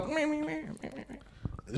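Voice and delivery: high, soft mocking meh; mocking blah blah. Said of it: "they just stand there and they go".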